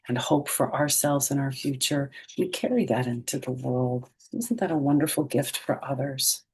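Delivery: plain reading voice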